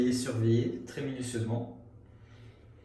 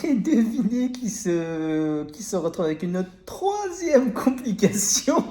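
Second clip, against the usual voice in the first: laughing; 9 semitones higher, 6 dB louder, slower than usual. Real, not acted. Announcing a third complication.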